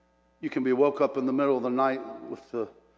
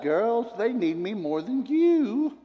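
higher, slow Mama brushing off her daughters; firm old mother settling it plainly; mother's stern reply; 6 semitones higher, much slower than usual. Retold or imitated. Mama tells her grown daughters off, slow and a little higher. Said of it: "And she'd say".

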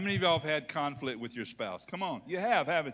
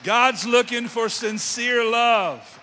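much louder and higher, slow; slow loud preached line; preaching declaration; 7 semitones higher, 12 dB louder, slower than usual. Real, not acted. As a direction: proclaim it.

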